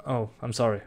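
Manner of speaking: ordinary talk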